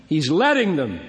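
ordinary delivery

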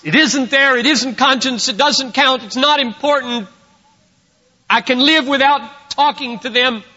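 high, loud protesting voice; insistent denial. Acted. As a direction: rattle off excuses as someone in denial. Voices a guilty person's denials.

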